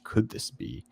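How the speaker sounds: softly insinuating mock question; mock suggestive. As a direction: imitate a coy, suggestive hint, hushed. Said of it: "They use this suggestive language".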